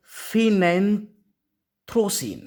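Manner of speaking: phenanthroline drawn out syllable by syllable, very slow; teacher stretching the word for students to repeat; spelled out slowly. Shown as a demonstration. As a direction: sound out each syllable of phenanthroline for the class. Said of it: "क्या बोलते हैं?".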